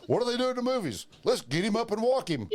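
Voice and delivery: high, fast mock panic; panicked teens. Friends deciding how to save John, high hurried voice. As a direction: rush it like clueless kids. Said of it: "we're like".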